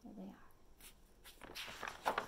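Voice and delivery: ordinary delivery, nothing marked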